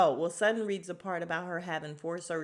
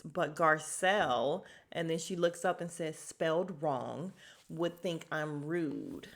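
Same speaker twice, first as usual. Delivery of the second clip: slow deliberate reading. Retold or imitated. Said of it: "Garcelle reads".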